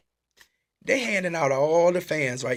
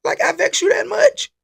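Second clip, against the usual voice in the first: much higher, louder indignant questioning; mock challenge; 6 dB louder than usual. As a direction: demand answers like you're offended. Acted challenge to the usher.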